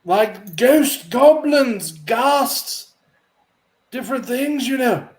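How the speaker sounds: high, pious priest voice; a fussy holy man, busy but polite. Acted. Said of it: "he stops and goes".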